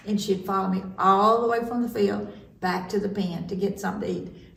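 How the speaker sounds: ordinary delivery, nothing marked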